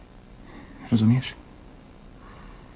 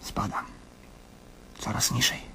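whispered; 6 semitones lower, 12 dB quieter than usual. Acted. Despairing man whispering in the night.

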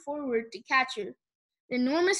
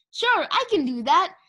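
higher, louder eager reply; bright; 6 semitones higher, 5 dB louder than usual. A narrator's reading. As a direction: chirp it eagerly. Sunny brightened.

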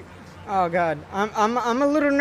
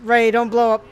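ordinary talk

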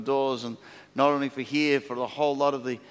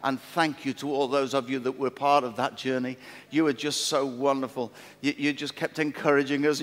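ordinary talk

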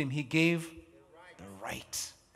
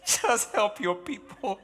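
mock tearful prayer; 5 semitones higher, 4 dB louder, slower than usual. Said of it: "I'd be like".

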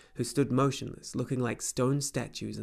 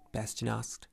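plain reading voice

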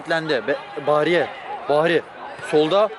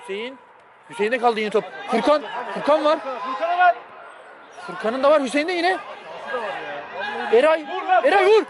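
excited commentary; 8 semitones higher than usual. Real, not acted.

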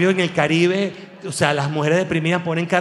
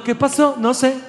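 high, startled "¿Qué pasó?"; mock bewildered question; 7 semitones higher than usual. People asking after the nuclear strike, high bewildered. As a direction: act baffled as the world ends. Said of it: "Y todos preguntando".

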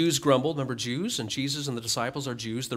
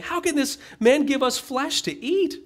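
incredulous question; 12 semitones higher, 4 dB louder than usual. Retold or imitated.

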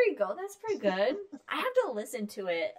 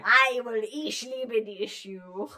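louder, slow fake German; hammed-up German, raised voice; mock-German yell; 5 dB louder, slower than usual. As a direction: drag it out like a German threat. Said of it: "You gotta yell it".

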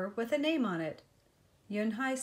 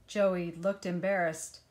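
ordinary delivery, nothing marked

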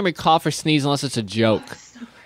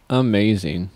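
usual speaking voice

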